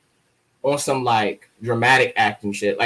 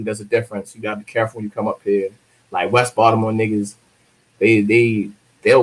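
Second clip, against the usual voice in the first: ordinary delivery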